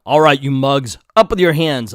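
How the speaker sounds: loud hero's challenge; mock tough command. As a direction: order the crooks about, loudly. Hero confronts the crooks.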